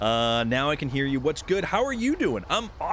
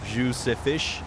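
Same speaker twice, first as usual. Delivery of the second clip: mock French; slower than usual. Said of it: "I believe you mean if the".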